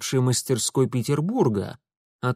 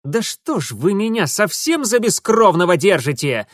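higher, louder indignant protest; affronted, louder outburst; 7 semitones higher, 6 dB louder than usual. A narrator's reading. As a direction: take offence loudly. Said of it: "возмутился Урусов".

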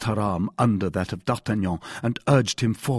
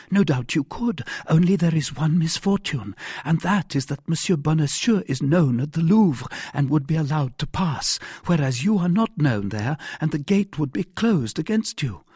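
high-pitched woman making her case; earnest heroine, objecting; 7 semitones higher than usual. A narrator's reading. Wife points out her rescuer is not known at the Louvre, earnest. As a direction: reason with him in a lifted female voice.

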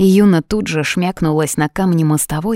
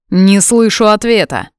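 higher, louder, impatient demand; king presses his secretary for an answer, impatient; 5 semitones higher, 5 dB louder than usual. A narrator's reading. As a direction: insist on an answer.

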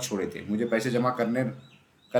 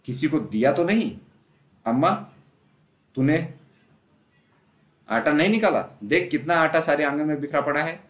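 higher, agitated mother; rattled and accusing; half-cornered, still scolding; flustered scolding; 4 semitones higher, 3 dB louder than usual. A narrator's reading. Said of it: "सिट पिटाकर बोली".

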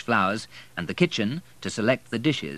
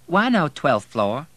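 feigned innocence; 8 semitones higher, 7 dB louder, slower than usual. Acted. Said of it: "she asked innocently".

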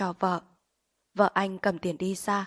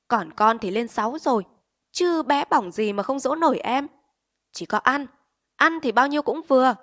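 higher, louder scolding tirade; yelling back at husband; 4 semitones higher, 7 dB louder than usual. A narrator's reading. Wife yells at her husband for losing the coat, furious. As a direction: tear into him and the kids. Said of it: "quát lại chồng".

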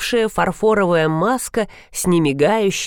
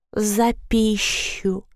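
slow, higher gurgling water spirit; gurgling creature; 4 semitones higher, 3 dB quieter, much slower than usual. Acted. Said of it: "пробулькала Мавка". (булькающий голос мавки)

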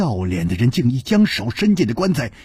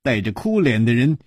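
ordinary delivery, nothing marked

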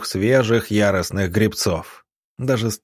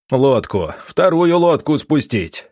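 raised, higher-pitched command; distant shouted order; 4 semitones higher than usual. A narrator's reading. Pursuer ordering the camp to launch a boat, shouted command. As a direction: call the order out across the water. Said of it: "донеслось сквозь шум".

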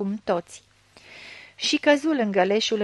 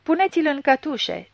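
yelled order; 5 semitones higher than usual. A narrator's reading. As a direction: bark the arrest order. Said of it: "zbieră la Gradora".

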